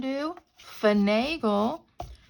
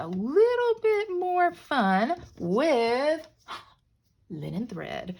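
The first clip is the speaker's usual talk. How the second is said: dramatic; 5 semitones higher, slower than usual. Shown as a demonstration. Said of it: "I was dramatic".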